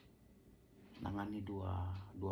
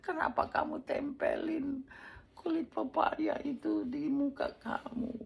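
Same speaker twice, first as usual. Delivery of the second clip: high, loud tearful question to his wife; anguished high plea, close to crying; near tears; 8 dB louder, slower than usual. Retold or imitated. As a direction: cry out in dismay, on the verge of tears. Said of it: "Saya mau nangis".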